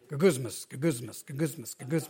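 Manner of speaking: low, soft repeated grumble; grumbling sound. Shown as a demonstration. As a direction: mimic grumbling under your breath. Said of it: "That's what it sounds like".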